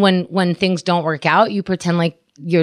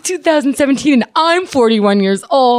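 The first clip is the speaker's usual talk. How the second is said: exasperated scolding; 7 semitones higher than usual. Retold or imitated. Imagined rant at mother.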